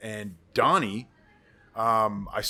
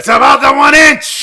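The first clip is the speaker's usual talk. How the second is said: much higher and louder, rallying the team; mock pep-talk shout; 23 dB louder than usual. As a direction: bellow it like a coach before the big game. Echoing the famous pep talk.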